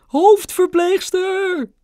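very high, long loud shriek; piercingly high drawn-out call; grandma's scream. A narrator's reading. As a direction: scream for the head nurse, dragging it out. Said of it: "gilde oma".

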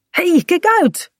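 high, drawn-out cry of warning. A narrator's reading. Bink warns Kaat as she leaps into the boat, warning. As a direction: warn them to watch out, pitch jumping up. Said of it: "waarschuwt Pink".

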